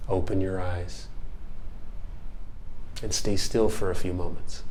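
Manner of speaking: hushed, unhurried mindfulness guide; guided meditation. Acted. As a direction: close the exercise gently, leaving long pauses. Leads a body scan.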